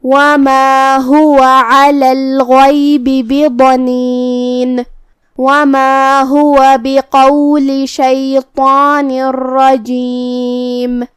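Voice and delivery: drawn-out melodic tajweed chant; slow Quran recitation. Shown as a demonstration. A teacher reciting the verse for students, slow melodic chant. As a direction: recite the verse slowly with full elongations.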